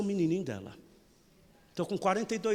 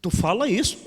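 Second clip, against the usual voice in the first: shocked parishioner; 5 semitones higher, 8 dB louder than usual. Retold or imitated. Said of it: "Mas, padre".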